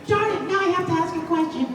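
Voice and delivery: high, quick put-upon grumble; high fast mock complaint; mock grumbling girl. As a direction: rush it like a sulky kid. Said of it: "voicing the shy girl".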